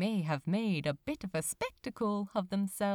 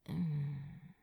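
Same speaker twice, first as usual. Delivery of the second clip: sleepy hum; 6 semitones lower, 5 dB quieter than usual. Acted. Yawning, snuggling into bed.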